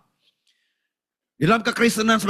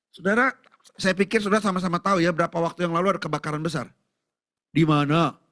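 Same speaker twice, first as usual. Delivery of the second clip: normal talking voice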